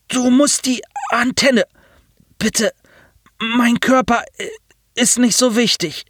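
high, halting voice stressing musst; insistent machine voice. A narrator's reading. Pilot insists Nasir fetch the antenna, high stiff pleading. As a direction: plead with stiff, broken urgency.

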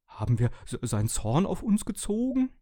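stockend, dann hohe Stimme; ängstliches Tuscheln. A narrator's reading. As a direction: furchtsam stotternder Wasserkocher. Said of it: "tuschelte der Wasserkocher". (bange, hoch ansteigende Frage)

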